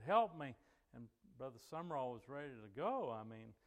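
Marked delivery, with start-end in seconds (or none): 0.0-0.5 brief, loud, higher plea; imitating a tearful cry for help; retold cry for help. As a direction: mock-desperate call for help. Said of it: "she just cried and cried".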